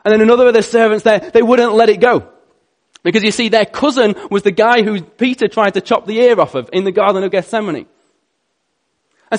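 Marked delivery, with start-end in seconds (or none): none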